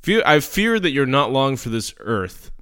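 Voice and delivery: normal talking voice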